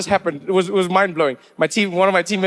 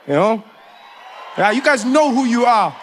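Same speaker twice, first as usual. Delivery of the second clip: shouted teasing callout; playful callout; 3 semitones higher than usual. Real, not acted. Trevor calls out the South Africans in the crowd, teasing shout. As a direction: call them out across the room. Calling out the audience.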